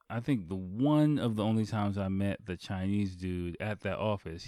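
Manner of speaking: ordinary talk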